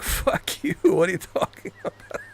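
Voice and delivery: high-pitched quote dissolving into laughter; laughing mock outrage. Retelling friend's angry text reply, laughing through it. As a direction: crack up while imitating an angry friend.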